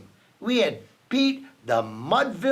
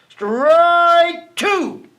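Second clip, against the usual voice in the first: much higher, much louder strike call; umpire's shout; 10 semitones higher, 10 dB louder than usual. A narrator's reading. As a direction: belt it like an umpire. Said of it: "called the umpire".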